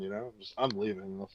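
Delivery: normal talking voice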